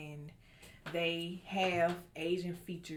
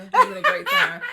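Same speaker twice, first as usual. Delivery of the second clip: laughing; 14 dB louder than usual. Real, not acted. Friend cracks up, fake leaving.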